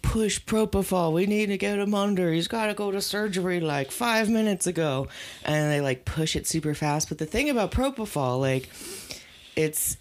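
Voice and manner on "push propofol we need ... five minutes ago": lightly voiced urgent doctor. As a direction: rush the orders a bit higher.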